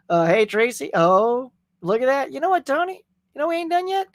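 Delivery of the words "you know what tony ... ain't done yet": teasing challenge. Real, not acted.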